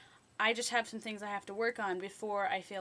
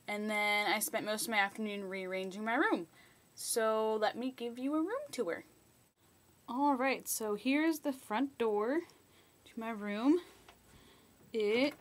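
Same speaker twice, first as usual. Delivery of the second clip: ordinary talk, nothing marked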